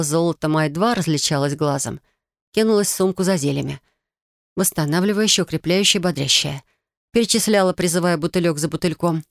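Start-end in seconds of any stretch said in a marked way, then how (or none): none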